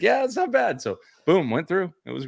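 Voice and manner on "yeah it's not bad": high, loud casual brag; smug nonchalance. Retold or imitated. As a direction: play it cool, proudly. His own proud reply.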